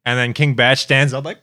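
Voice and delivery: normal talking voice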